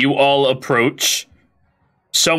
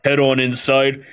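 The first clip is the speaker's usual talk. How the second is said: ordinary delivery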